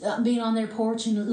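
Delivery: ordinary delivery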